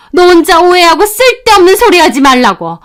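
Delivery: 소리 hit high and loud in a menacing warning; threatening warning. Retold or imitated. Teacher threatening the student, high and loud. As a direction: bark 쓸데없는 소리 하지 말라고 as a threat. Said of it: "경고하시더라고요".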